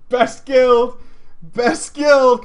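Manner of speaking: loud, high, emphatic boast; hyped cheering. Real, not acted. Streamer boasting about his old guild, hyped.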